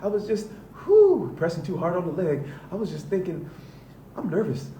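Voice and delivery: high, loud, nervous little voice; a jittery voice pleading its case; timid inner judgment. Pretend. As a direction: play your fearful inner critic squeaking out excuses. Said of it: "And judgment goes".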